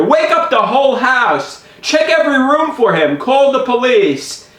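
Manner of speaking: high-pitched panicked orders; alarmed and insistent; frantic urging. Retold or imitated. Frantically urging mom to act.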